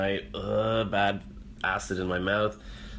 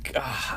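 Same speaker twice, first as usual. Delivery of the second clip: frustrated whispered gah; 2 semitones higher than usual. Real, not acted. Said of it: "I shouldn't take melatonin".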